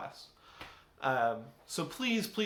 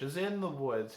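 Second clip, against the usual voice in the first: ordinary delivery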